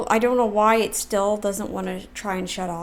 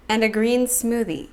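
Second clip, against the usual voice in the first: ordinary delivery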